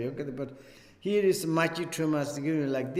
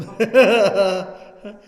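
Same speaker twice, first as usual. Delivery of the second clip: laugh; 6 semitones higher, 13 dB louder than usual. Real, not acted. Amused at own explanation.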